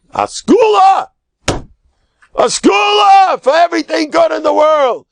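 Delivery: high, ringing preacher's shout, drawn out slow; exultant shout. Acted. Rabbi proclaiming the gift to his listeners, high ringing shout. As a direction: proclaim it like a great blessing.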